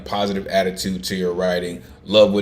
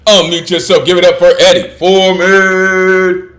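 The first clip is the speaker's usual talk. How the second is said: hype host call-out; 8 semitones higher, 9 dB louder than usual. Real, not acted. Host hyping the next poet.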